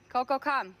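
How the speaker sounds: loud, high call; calling the dog. Real, not acted. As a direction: call the dog over sharply.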